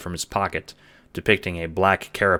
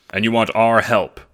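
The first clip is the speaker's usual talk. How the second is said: louder, questioning; John's voice; 4 semitones higher, 8 dB louder than usual. Acted. John questioning Jacob's request, doubtful. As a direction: ask it squarely.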